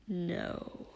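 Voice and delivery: low, soft, toneless refusal; deadpan. A narrator's reading. Dabi refusing the three of them, deadpan. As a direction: drop it low with no feeling. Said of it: "Voice deadpan".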